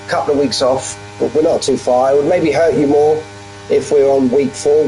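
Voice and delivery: ordinary talk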